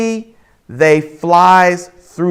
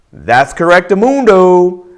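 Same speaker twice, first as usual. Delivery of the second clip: loud, higher-pitched cheer for a right answer; celebratory catchphrase; 5 semitones higher, 6 dB louder than usual. Real, not acted. Teacher rewarding students for the right answer, celebratory. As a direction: crow the catchphrase in triumph.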